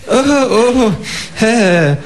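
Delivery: high, startled oh oh; surprised kangaroo. Acted. Kangaroo surprised the door opens so fast, high and startled. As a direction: caught off guard, squeaky. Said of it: "sagt das Känguru überrascht".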